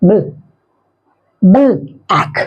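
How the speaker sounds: "बल" as a closed bl blend, then "आंख" apart as ack; teacher separates "बल" from "आंख", no open a after the l; correct blend demo. Shown as a demonstration. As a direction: sound out "बल", pause, then "आंख". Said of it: "इसका शुद्ध उच्चारण क्या है".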